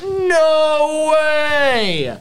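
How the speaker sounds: high, loud squeal of disbelief; excited disbelief. Retold or imitated. Fans on a bus spotting a star, squealing. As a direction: squeal it like you can't believe it. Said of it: "And we're like".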